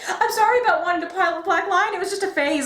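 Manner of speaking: pleading Lance. Retold or imitated. Fan mimicking Lance begging the Blue Lion, mock groveling. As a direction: beg like a sorry boyfriend. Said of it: "he was just like".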